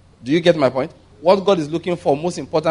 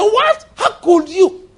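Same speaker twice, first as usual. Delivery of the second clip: much higher and louder, shocked; shocked outrage; 5 dB louder, much slower than usual. Acted. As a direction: gasp it out in disbelief. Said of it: "people said".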